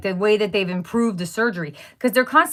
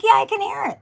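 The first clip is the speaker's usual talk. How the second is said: exasperated; 12 semitones higher than usual. Retold or imitated. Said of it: "I'm like".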